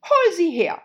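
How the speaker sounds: very high, loud shrieking queen; evil queen. A narrator's reading. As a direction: shriek the command like a tyrant. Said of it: "sagte die Königin".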